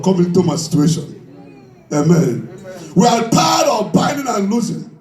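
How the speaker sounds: ordinary delivery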